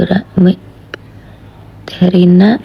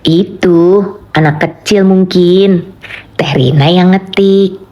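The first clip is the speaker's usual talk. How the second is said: even, slow read of "Itu anak kecil mungkin"; slow level intonation; slower than usual. Shown as a demonstration. Streamer showing chat another intonation, slow and level. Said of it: "Kan bisa begitu juga tuh notasinya".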